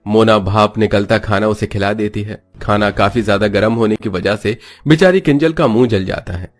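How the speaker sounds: ordinary delivery, nothing marked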